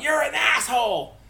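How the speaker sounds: high-pitched mock yell; imitated kid's yell. Girl chasing the dog around the house, mock yell. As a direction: yell it out like an exasperated child. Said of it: "she yells out".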